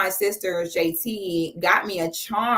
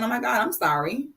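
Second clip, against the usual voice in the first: ordinary talk, nothing marked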